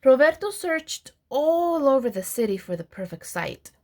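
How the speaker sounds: ordinary delivery, nothing marked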